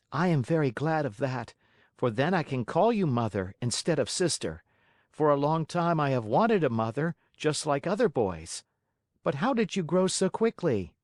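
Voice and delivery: put-on high boyish puppet voice; a lightly voiced young puppet; Pinocchio voice. A narrator's reading. Pinocchio speaks.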